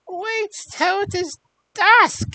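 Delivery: high, slow witch voice; old hag voice. Acted.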